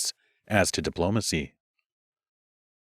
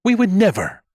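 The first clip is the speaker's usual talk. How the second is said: much higher, loud indignant snap; snapped; 12 semitones higher, 6 dB louder than usual. Acted. Music mage snapping at the accusing queen. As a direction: snap the denial. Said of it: "Music snapped".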